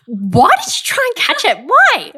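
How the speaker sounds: high, rising mock disbelief; incredulous questioning. Quoting mortified friend about the catch, incredulous.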